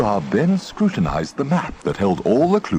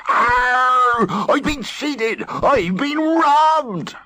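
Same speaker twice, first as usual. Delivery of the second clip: outraged wail; much slower than usual. A narrator's reading. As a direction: howl like you've been robbed. Pirate discovers he was cheated.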